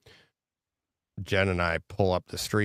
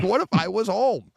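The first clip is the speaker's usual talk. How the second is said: high, pitched-up mock alarm; mock panicked worry; 13 semitones higher, 4 dB louder than usual. Telling his co-host what scared him, high mock panic. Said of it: "I'm like".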